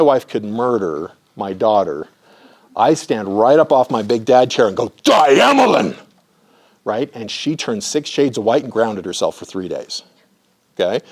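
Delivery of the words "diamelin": loud, high furious bark of a name; angry shout. Retold or imitated. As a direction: shout her name in sharp fury. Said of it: "I rarely express that kind of anger".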